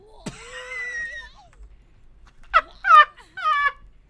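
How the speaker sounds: long, loud high-pitched laugh scream; shrieking laugh. Real, not acted. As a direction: let out a hysterical cackling shriek. Fleeing killer, shrieking laugh.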